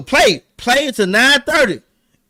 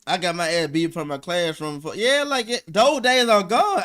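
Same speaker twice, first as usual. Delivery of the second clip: ordinary delivery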